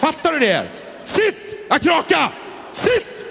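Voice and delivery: high shrieked commands like to a dog; shrieking command. Acted. Hostess screaming at a guest to sit, shrieking high.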